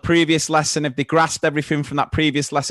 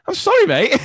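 mock apology; 3 dB louder than usual. Teacher replying to kid.

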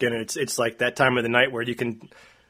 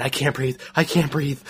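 mock panicked muttering; 3 semitones higher than usual. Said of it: "She's talking to herself".

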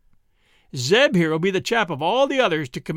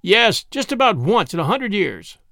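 a mild read snarl, a bit higher and louder; 6 semitones higher, 5 dB louder than usual. A narrator's reading. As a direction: throw his words back with a light snarl. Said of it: "snarled Zeke".

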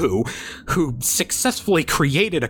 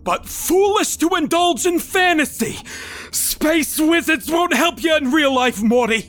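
lecturing father; 12 semitones higher than usual. Acted. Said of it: "admitted my father".

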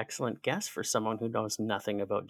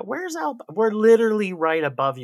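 exasperated retort; 6 semitones higher, 7 dB louder than usual. Retold or imitated.

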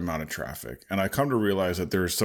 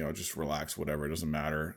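soft, brushing-off imitation of the wife; a quieter, offhand take on the wife telling him to relax; dismissive calm; 4 semitones lower, 10 dB quieter than usual. Wife's response, retold.

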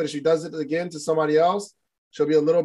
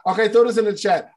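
ordinary talk, nothing marked

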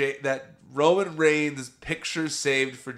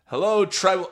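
higher, louder, chirpy phone greeting; eager Sammy greeting; 7 semitones higher, 7 dB louder than usual. Retold or imitated. Sammy answers Roman's phone proudly, higher and louder. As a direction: answer the phone like an overeager sidekick. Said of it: "Sammy just goes".

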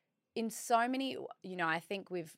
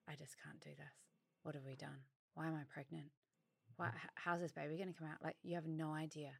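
much softer, fast, teary retelling of a breakdown; retold crying; 2 semitones lower, 10 dB quieter, much faster than usual. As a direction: murmur it as if crying down the phone. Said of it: "called Mark crying, just crying".

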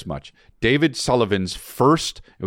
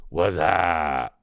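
drawn-out "wassup" catchphrase, lower; imitated acting line; 5 semitones lower, 3 dB quieter than usual. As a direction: mimic a friend's one acting line, stretched out. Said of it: "he had one line, and it was".

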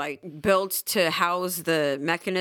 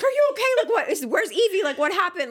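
worried housemates; 11 semitones higher, 4 dB louder than usual. Retold or imitated. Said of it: "There's people in the house like".

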